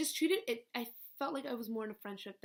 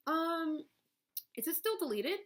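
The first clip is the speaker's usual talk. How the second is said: mock innocent probe; 8 semitones higher, 4 dB louder than usual. Baiting boyfriend on phone.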